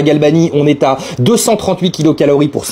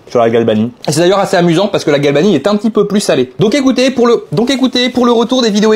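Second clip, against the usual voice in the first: chewing while talking; speaking around food; mouth full; 2 semitones higher than usual. Real, not acted. Seen 4: Holds mozzarella, bites at 1.6–1.8s, then speaks while chewing.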